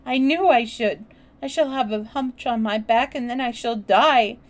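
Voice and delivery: distraught, tearful lament; choked wail. A narrator's reading. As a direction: wail it in despair. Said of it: "choked out Colin".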